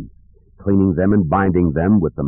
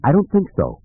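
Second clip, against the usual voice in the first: higher, confident rebuttal; fresh certainty; 8 semitones higher than usual. A narrator's reading. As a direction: answer with renewed certainty. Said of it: "Luke said with fresh certainty".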